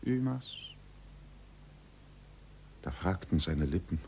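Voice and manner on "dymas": quiet high echo of the name; soft mocking echo. A narrator's reading. Echo mimicking the man from the grotto, soft. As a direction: let it drift back faintly like a cave. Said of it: "äffte leise".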